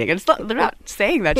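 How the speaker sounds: usual speaking voice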